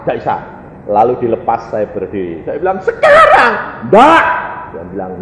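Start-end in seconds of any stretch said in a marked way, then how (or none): 2.8-4.2 high, loud stubborn outburst; membantah ngotot. Retold or imitated. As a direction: protest at the top of the voice. Acted stubborn argument with God. (teriak membantah dengan nada tinggi)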